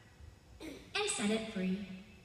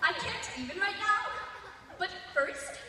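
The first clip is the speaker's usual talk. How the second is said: put-on higher, louder valley-girl gush with laughs; gushing teen voice; 4 semitones higher, 5 dB louder, slower than usual. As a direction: squeal like an excited teenager. Old man thinks he's young.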